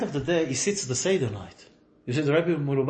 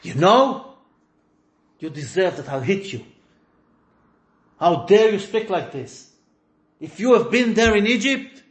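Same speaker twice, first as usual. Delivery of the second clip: raised, louder, higher voice, threatening the son; harsh scolding; 6 semitones higher, 7 dB louder than usual. Shown as a demonstration. Father harshly scolding the son at the Seder table, loud angry scolding. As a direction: scold him like a furious father. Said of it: "No, that's not the way you say it".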